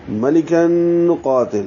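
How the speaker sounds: slow ملکن قاتل, humming the n through the nose; nasal ghunna. Shown as a demonstration. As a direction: hold a long nasal n joining ملکن to قاتل. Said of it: "یہاں بھی غنہ ہوگا".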